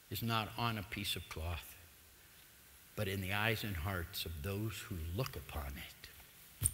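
soft, slow whisper; hushed whisper. Real, not acted. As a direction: breathe the words out slowly. Solemn closing thought whispered.

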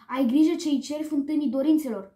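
ordinary delivery

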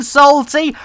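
ordinary delivery

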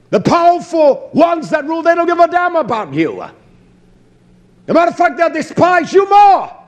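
fiery sermon climax; preaching shout. Real, not acted. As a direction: hammer it home from the pulpit.

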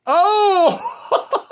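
loud high "oh" breaking into laughter; delighted whoop. Real, not acted. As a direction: whoop and crack up. Pulls another good card.

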